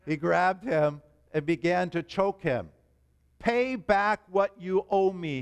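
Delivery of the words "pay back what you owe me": slow, higher demanding voice. A narrator's reading. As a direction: lean on each word to demand the money. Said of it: "he demanded".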